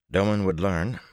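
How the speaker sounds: plain reading voice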